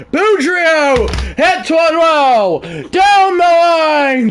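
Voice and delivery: loud, high, drawn-out play call; excited hit call. Real, not acted. Gamer calling his batter's big hit, excited shout. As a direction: shout it long and high as the ball flies.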